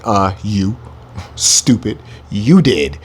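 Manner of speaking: low, dismissive imitation of the other nations; lightly voiced scornful onlooker; mocking nations. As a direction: sneer it like the whole world is answering.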